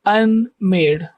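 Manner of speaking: "unmade" drawn out as un, then made; syllables separated. Shown as a demonstration. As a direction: say "unmade" slowly in two parts. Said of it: "ये un अलग है". ("unmade" धीरे, un और made अलग-अलग)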